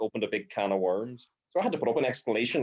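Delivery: normal talking voice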